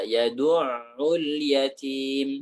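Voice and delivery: yadu'ul very slow and relaxed, the ain unforced. Shown as a demonstration. Teacher showing a relaxed ain to the student, very slow. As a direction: ease into yadu'ul gently, no strain on the ain. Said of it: "Santai saja".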